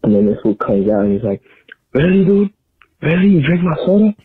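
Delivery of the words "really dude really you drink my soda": much higher, louder whiny brother; mocking the brother. Brother finding his soda gone, high whiny accusation. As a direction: squeak out the outraged brother.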